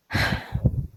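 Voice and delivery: soft, acted sigh; character sigh. Tara before explaining her line of work, soft sigh. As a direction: let out a quiet breath in character. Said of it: "She sighs".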